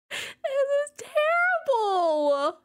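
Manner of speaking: high, slow drawn-out wail; mock wail. Real, not acted. Streamer to her chat after the gross salad, high drawn-out wail. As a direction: stretch it out high like a mock lament.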